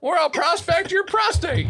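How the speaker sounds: put-on high shouted old trapper; gruff mountain man. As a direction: yell it like a crazed old prospector.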